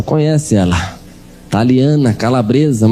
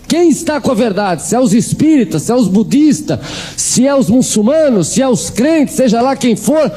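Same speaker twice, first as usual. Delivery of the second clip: each faith punched out higher; mother listing religions to God, pitched high; high, listing plea; 9 semitones higher than usual. Retold or imitated.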